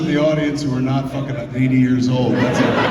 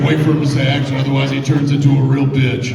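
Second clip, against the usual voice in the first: loud, snide mock warning; mocking a director's warning; 8 dB louder than usual. Retelling a co-worker's warning in a snarky tone. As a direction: sneer it like gossip about a diva. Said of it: "He's like".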